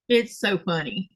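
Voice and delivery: ordinary delivery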